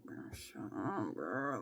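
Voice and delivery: low, slow, soft grumble; annoyed mutter. Retold or imitated. Grumbling about the yapping recruit, annoyed. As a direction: mutter it under your breath, fed up. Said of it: "I'm just like".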